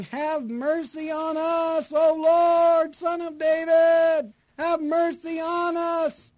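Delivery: high, slow, shouted plea for mercy; desperate shout. Acted. Blind beggars by the road shouting to Jesus for mercy. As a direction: cry out with everything you've got. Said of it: "cried out all the more".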